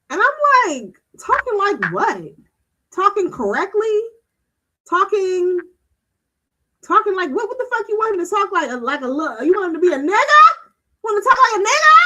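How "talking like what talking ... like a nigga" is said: mock-incredulous retort, building louder and higher; retold indignant disbelief. Friend challenges a mother over her articulate son, indignant. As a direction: fire back questions in raised, baffled disbelief.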